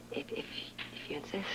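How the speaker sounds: hesitant whisper. Acted. Reluctant giving in to worry.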